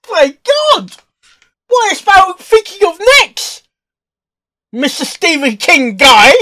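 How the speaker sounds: loud, high, thrilled disbelief; excited outburst. Real, not acted. Reacting to the fanfic reading, loud excited disbelief. Amazed at the author.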